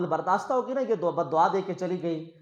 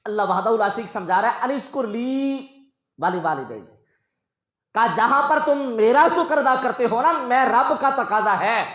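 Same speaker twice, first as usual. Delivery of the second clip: fervent preaching; 5 semitones higher, 7 dB louder than usual. Acted. Preacher urging the congregation to thank parents, loud fervent preaching. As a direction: preach it with full force. Preacher explaining Quran verse.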